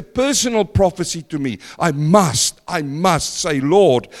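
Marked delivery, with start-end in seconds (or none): none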